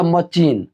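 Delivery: मशीन said with "ch", "ma-cheen"; old wrong pronunciation. Shown as a demonstration. Teacher confessing own old mistake, wrong pronunciation. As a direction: swap in a "ch" sound: मशीन as "ma-cheen". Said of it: "मेरे दिमाग में हमेशा आता".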